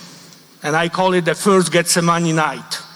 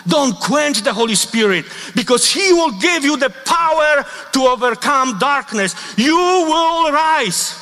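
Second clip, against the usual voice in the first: fervent preaching; 6 semitones higher than usual. Acted. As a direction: build the plea up to a triumphant call. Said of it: "preaching plea".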